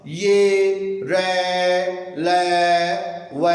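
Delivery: र drawn out very slowly, then ल, व; slow semivowel demo; breath-driven demo. Shown as a demonstration. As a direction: push air up from the belly on र ल व. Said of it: "अंदर से अपने पेट की वायु आ रही है बाहर".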